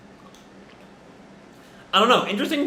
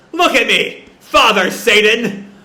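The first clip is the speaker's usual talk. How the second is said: loud, higher, slowed scream to the sky; 5 semitones higher, 6 dB louder, slower than usual. Acted. Reiji screaming for Satan's attention, loud and drawn out. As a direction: scream it out to the father. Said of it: "And he just screams".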